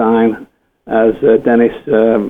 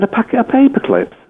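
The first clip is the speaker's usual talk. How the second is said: very high-pitched disbelieving question; retold incredulity, voice shooting up; incredulous; 12 semitones higher than usual. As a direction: squeak it out in mock disbelief. Retold disbelief at mother's tiny order.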